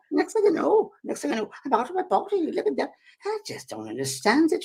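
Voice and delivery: high, puzzled Scottish lady; Scottish accent. Retold or imitated. Scottish lady describes leaving her body, high Scottish accent. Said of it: "I'm trying to my Scottish accent".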